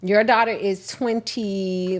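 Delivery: ordinary talk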